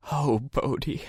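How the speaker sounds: high, broken grief over the dog; mourning her dead dog, voice breaking; grieving cry. A narrator's reading. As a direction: let the grief crack the name. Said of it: "she started to cry".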